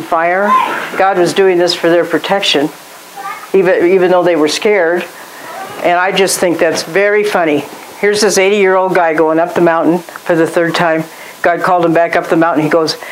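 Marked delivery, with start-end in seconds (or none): none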